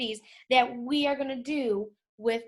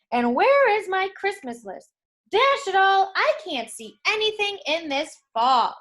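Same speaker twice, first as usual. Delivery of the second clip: louder, higher grumbling exasperation; cross Santa; 7 semitones higher, 4 dB louder than usual. Acted. Santa fuming at the fog and missing list, louder. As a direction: fuss loudly at the lost list. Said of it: "Even Santa was cross".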